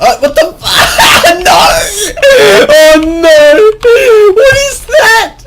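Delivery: building to a high, loud cry of disbelief; shocked outburst. Real, not acted. Gamer killed unfairly in game, shouting in shocked disbelief. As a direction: rise from a laughing gasp into a full wail of protest.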